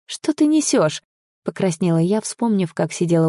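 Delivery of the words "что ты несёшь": high-pitched, incredulous protest; much higher, taken aback; flustered disbelief. Acted. Embarrassed protest at partner's claim.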